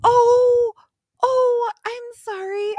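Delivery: very high, imitated flustered sympathy; alarmed sympathy. Young receptionist facing a sobbing customer, alarmed sympathy in a high voice. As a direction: squeak out a mock-alarmed apology. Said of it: "who was more alarmed, me or this poor young girl".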